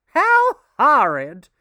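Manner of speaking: high, loud, slow horrified cry. A narrator's reading. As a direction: cry out in disgust. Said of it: "cried Missus Otis".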